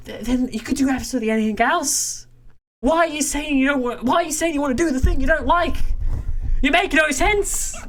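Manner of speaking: high-pitched, incredulous pleading; exasperated rant. Acted. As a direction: argue high and fast, then drag out the last line. Acted exasperated reply to friend.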